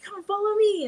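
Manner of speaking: high-pitched rallying call, retold; Spider-Man calling out. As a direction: imitate a hero summoning the kids.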